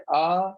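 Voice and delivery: "آ" held long and even; drawn-out vowel. Shown as a demonstration. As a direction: stretch "آ" into a long open aa. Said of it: "الف سے آواز کھینچتی ہے".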